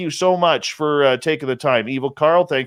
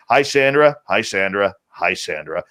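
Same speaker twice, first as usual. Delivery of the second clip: each "Hi, Sandra" lower than the last; playful repeated hello; 7 semitones lower than usual. Pretend. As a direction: sink the greeting step by step into a low voice. Playful repeated greeting sliding down.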